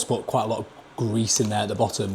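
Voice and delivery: ordinary delivery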